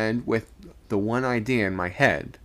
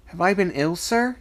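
higher, startled question; exclaimed; 9 semitones higher, 3 dB louder than usual. A narrator's reading. Dazed boy exclaiming to the second mate, startled and high. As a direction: ask it in dazed surprise. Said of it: "he exclaimed".

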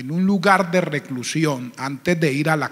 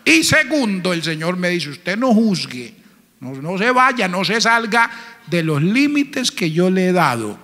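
loud emphatic sermon voice; preaching; 4 dB louder than usual. Acted. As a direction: drive the point home to the church. Preaches point two.

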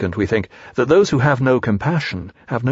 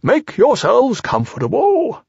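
high, slow, hospitable owl; drawn-out, pitched-up owl voice; Owl, kindly; 12 semitones higher, slower than usual. A narrator's reading. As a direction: settle your guests in as a kindly owl. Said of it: "said Owl kindly".

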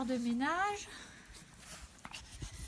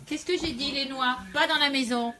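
sharp loud telling-off, higher and louder; scolding the dogs; 4 semitones higher, 10 dB louder than usual. Real, not acted. Owner scolding her dog for nuts indoors, loud and sharp.

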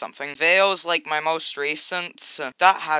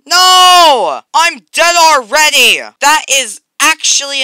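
much higher, loud cry of protest; frustrated yell; 10 semitones higher, 12 dB louder, slower than usual. Real, not acted. Gamer just killed by another player, yelling in frustration. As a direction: scream it as you get killed.

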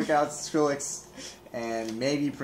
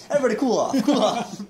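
laughing, louder call to calm down; 8 semitones higher, 7 dB louder than usual. Real, not acted. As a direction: laugh while shouting it out.

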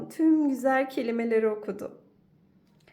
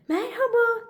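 very high, loud happy bear; little bear's hello; 12 semitones higher, 6 dB louder than usual. Acted. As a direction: greet friends with delight. Little bear greets friends.

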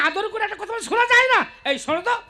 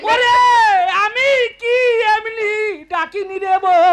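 wailing excuse; 3 semitones higher, 5 dB louder, slower than usual. Acted. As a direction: justify yourself through drawn-out crying. Brother wails his excuse. (সুর করে কেঁদে সাফাই)

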